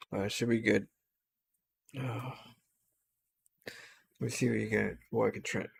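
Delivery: sleepy, worn-out mumble; drowsy and drained; tired. Real, not acted. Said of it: "my body's just too tired right now".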